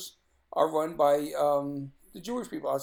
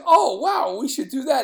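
loud, high eager reply; bursting with delight at the idea; excited; 6 semitones higher, 9 dB louder than usual. Retold or imitated. Son eagerly urging his father, loud and excited. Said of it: "I said".

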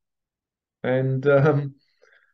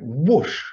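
quick rising whoosh noise; vocal sound effect of a sling shot; imitated whoosh; 5 semitones higher, 4 dB louder than usual. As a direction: mimic a stone whizzing past, higher. Imitates sling stone flying.

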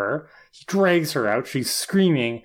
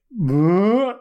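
higher, louder drawn-out monster noise; mock demon; 7 semitones higher, 5 dB louder than usual. Retelling the demon's sudden end, mock demon noise. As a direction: imitate a demon with a comic swoop. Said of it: "the demon is like".

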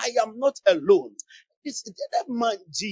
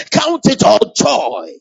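loud preaching; 12 dB louder, much slower than usual. Real, not acted. Preaching climax.

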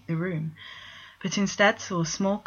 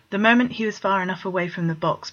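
usual speaking voice

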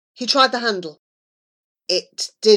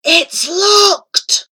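whisper yelling; 9 semitones higher, 7 dB louder than usual. A narrator's reading. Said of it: "Charlie whisper shouted".

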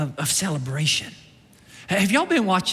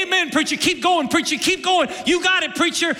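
higher, eager shouts of encouragement; cheering the preacher; 8 semitones higher than usual. Retold or imitated. Church members cheering their preacher on, higher and eager. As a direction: urge the preacher on.

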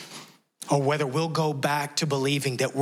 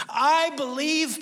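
much higher, slow tearful cry; 12 semitones higher, much slower than usual. Retold or imitated. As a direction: draw it out high like he's breaking down. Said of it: "And he just cries".

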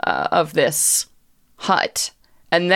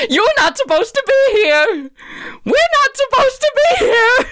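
very high, louder creature wail; frantic creature; 4 dB louder than usual. Acted. Gordon telling the witch she shouldn't be here, frantic. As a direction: build to a high-pitched frenzied protest. Gordon's protest peaks before cackling.

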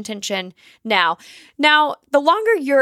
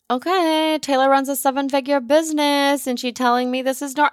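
mock sceptical listener; 3 semitones higher than usual. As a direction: mimic an unconvinced listener.

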